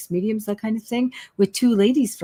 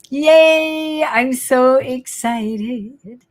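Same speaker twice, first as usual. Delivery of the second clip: high cheer, then slow drawn-out words; celebrate, stretching every word; excited cheer; 6 semitones higher, 5 dB louder, much slower than usual. Real, not acted. Psychic telling viewers about her office, excited cheer.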